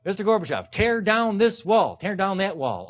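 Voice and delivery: a hammed-up presidential demand; Reagan's Berlin Wall line. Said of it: "what he said at the Berlin Wall".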